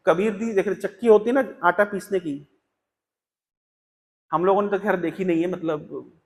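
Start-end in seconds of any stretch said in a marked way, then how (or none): none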